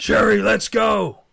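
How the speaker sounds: high, loud, drawn-out shout; imitated off-camera yell; calling her off. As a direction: holler for someone to come along. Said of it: "he just calls her off".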